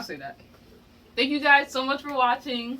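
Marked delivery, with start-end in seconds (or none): 1.2-2.8 loud outro. Real, not acted.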